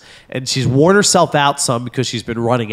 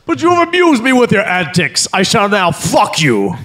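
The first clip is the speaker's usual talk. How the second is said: high, pompous Viking warrior voice; wife imagined as a Viking, pompous and high; Viking woman voice; 8 semitones higher than usual. Pretend. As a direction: proclaim it like a haughty Viking queen. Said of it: "kinda like a Viking".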